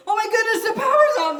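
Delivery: high, loud laughing alarm; mock panic shout. Teacher in dark classroom, laughing mock-panicked shout. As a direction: yell it like the lights just died.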